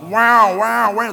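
loud, high wailing siren noise; imitated alarm siren. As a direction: wail like an alarm going off. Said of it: "The sirens would start going off".

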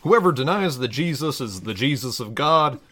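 measured, solemn Bible reading; scripture reading. Acted. Reading a parody Bible passage to listeners, solemn scripture voice. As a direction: read it like a pulpit lesson.

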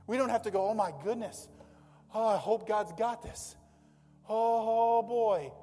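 loud, fretful mock panic; mock worried churchgoer. Pastor mocking worried believers, loud fretful panic. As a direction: ham up a fearful believer wringing hands.

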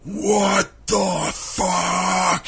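long drawn-out mock scream, pitched high; retold scream. Friend jumps up shouting in Red Lobster, mock scream. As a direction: stretch every word out in a high mimed yell. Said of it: "He's, like, screaming".

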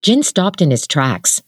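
ordinary delivery

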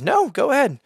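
a light imitation of her happy go-ahead; quoted woman's eager reply.

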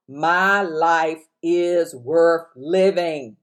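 very slow, every word weighed; slow affirmation. Shown as a demonstration. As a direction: let each word of the affirmation land slowly. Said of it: "speaking words of affirmation".

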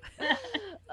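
high laugh. Real, not acted.

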